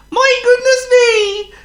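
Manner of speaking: high, loud, slow wail; wailing farmer's wife. A narrator's reading. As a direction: wail it out in dismay. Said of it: "wailed the farmer's wife".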